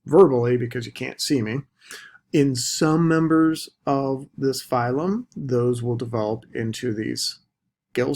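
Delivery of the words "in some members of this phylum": some stretched, members rising, slower; slow emphatic some members; stress on some. Shown as a demonstration. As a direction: draw out some, slow the phrase. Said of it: "I'm trying to emphasize this verbally".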